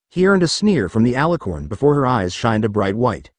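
plain reading voice

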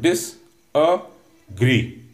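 "Disagree" in three very slow, separate beats; split into syllables. Shown as a demonstration. Teacher demonstrating three syllables to cadets, "Disagree" slowly broken up. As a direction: pause after each syllable of "Disagree", ending on a rise. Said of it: "There are three syllables".

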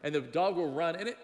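ordinary delivery

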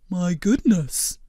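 slow, earnest blob creature voice; gentle, measured cartoon creature; Blobbert voice. A narrator's reading.

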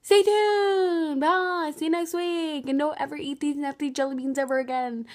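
loud, excited goodbye; loud outro. Real, not acted. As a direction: shout the sign-off.

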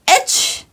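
breathy high fake sneeze; a sharp acted achoo. Reader fakes a sneeze for the children, breathy.

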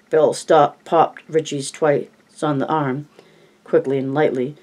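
plain reading voice

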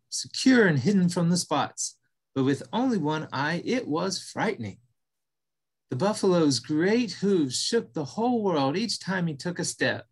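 calm storytelling voice; story narration. A narrator's reading. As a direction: read it like a bedtime tale. Reads the story aloud.

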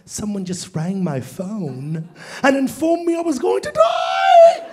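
polite British complaint rising to a shriek; imitating scared passenger. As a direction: start meek, end in a high mock wail. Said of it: "What if he's legitimately scared".

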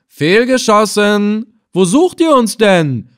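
slow, loud, high jeering taunt; mock the shooters from afar; mocking call. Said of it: "lachte so schallend wie möglich auf und fügte hinzu". (höhnisch, laut und gedehnt rufen)

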